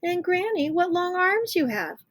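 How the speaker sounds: put-on high little-girl voice; wondering little girl. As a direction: ask like a wide-eyed child. Girl's famous questions to wolf.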